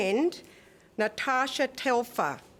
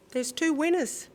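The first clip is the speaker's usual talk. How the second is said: surprised; 7 semitones higher than usual. Real, not acted. Surprised announcement of two winners.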